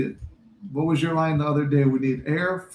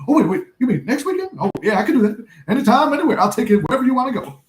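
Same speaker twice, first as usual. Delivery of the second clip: high, louder, laughing, tumbling fast; eager excitement; 8 semitones higher, 5 dB louder, faster than usual. Retold or imitated. Sherman jumping at the chance of a date, high and eager. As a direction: gush like a kid who just got a yes. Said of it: "He goes".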